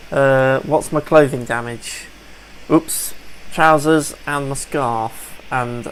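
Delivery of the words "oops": normal talking voice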